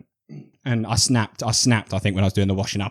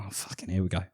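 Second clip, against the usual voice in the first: low, much softer under-the-breath grumble; muttered aside; 3 semitones lower, 12 dB quieter than usual. Retold or imitated. Grumbling to himself about seeing Wicked, muttered. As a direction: mutter it to yourself, resigned. Inner groan at partner's idea.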